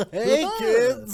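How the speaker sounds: high, loud silly clown voice. Acted. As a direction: yell it like a goofy party clown. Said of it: "warning before the clown voice".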